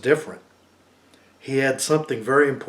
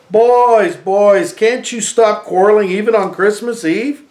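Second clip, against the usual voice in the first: scolding aunt; 7 semitones higher, 6 dB louder than usual. Acted. Aunt scolds quarreling boys.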